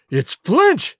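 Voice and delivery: high-pitched alarmed outcry; frightened cry. A narrator's reading. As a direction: cry out the dreaded name. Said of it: "cried Mary".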